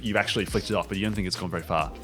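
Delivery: normal talking voice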